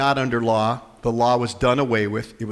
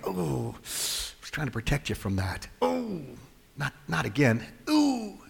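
lirih, much softer, aching; soft grieving sigh; 7 dB quieter than usual. Retold or imitated. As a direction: sigh it out like a hurt father. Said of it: "That's the disappointment".

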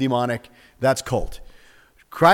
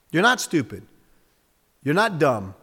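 usual speaking voice

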